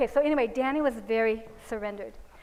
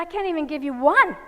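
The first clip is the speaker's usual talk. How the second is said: put-on loud, raised child's protest; imitated crying; 4 semitones higher, 9 dB louder than usual. Daughter refusing to spank mommy, mock tearful protest. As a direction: wail it lightly as a retold plea. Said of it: "she was crying the whole time".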